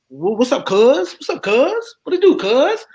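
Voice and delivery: high, sing-song California street talk; movie West Coast slang. Retold or imitated. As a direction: ham up the movie Cali greeting, pitch way up. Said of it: "I thought all y'all talk like that".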